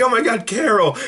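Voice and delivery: high-pitched mock fright; startled. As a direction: imitate the gang jumping at a scare. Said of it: "to, like, surprise them".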